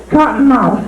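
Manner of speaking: high squeaky rabbit puppet. Acted. Ventriloquist voicing his rabbit puppet, high puppet voice.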